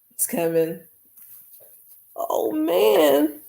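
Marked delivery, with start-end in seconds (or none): 2.2-3.4 high, drawn-out tearful sigh. Real, not acted. Streamer still moved by the picture, tearful. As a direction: let it out long and moved. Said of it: "I didn't mean to cry".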